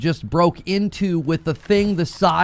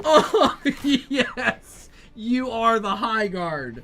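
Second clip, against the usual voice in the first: laughing; 9 semitones higher, much slower than usual. Real, not acted. Laughing announcement.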